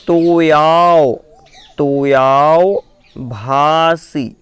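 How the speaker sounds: तोयाओ भासी drawn out slowly, each syllable clear; slow careful recitation. Shown as a demonstration. Teacher slowly sounding out तोयाओ भासी for students. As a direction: stretch out तोयाओ भासी for the learners. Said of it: "फिर से उच्चारित कराऊंगा".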